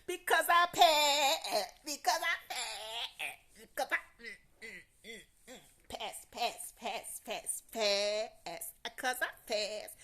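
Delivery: slow, high, loud gloating with laughter and humming; triumphant shout. Retold or imitated. Teasing friend Daphne about passing, high loud triumphant crowing. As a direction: crow it out, drawing out every pass. Revealing to Daphne she passed.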